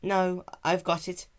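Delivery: a small pony straining at her task, determined; young filly Nyx. A narrator's reading. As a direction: Nyx insisting she can do it.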